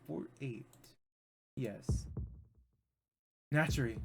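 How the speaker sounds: ordinary delivery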